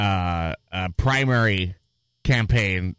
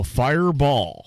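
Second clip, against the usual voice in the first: slow, drawn-out call of fireball; retold game call; 3 semitones higher, slower than usual. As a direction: stretch it out like an arena call. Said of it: "they would go".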